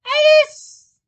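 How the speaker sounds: very high, loud squeaky call; shrill little voice. A narrator's reading. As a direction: squeal the name at the top of the voice. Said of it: "at the top of his shrill little voice".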